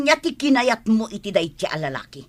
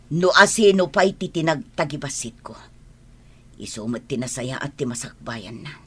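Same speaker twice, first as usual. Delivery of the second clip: ordinary talk, nothing marked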